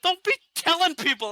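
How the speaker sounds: high, exasperated retold warning; warning squad leader. Teller scolding his squad leader, high and exasperated. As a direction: tell him off, voice jumping up. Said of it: "I said".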